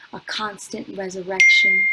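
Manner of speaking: proclaimed at full force; loud climax. Acted. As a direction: throw this line out as the peak. Climax of the poem.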